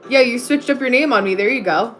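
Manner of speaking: ordinary delivery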